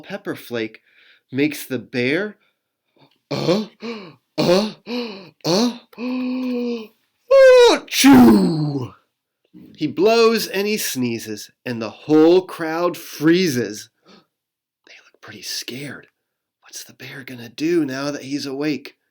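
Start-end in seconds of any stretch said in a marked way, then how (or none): 4.4-8.9 uh-uh-uh climbing higher and louder into a big sneeze; building sneeze. Acted. 14.1-19.0 hushed storytime whisper; suspense whisper. Acted. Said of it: "storyteller whispers suspense".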